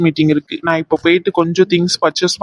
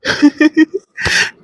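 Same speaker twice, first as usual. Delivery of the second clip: high giggle; light, high-pitched laugh; 7 semitones higher than usual. Acted. Alone, teasing the absent caller, high giggle. Amused after teasing phone call.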